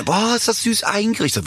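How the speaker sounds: high, slow mock gushing; gushing visitors. Guests gushing over the flat in Croatia, mock swooning. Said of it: "Und dann kommen die".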